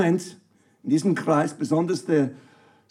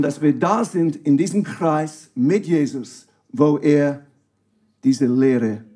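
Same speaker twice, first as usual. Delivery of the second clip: ordinary talk, nothing marked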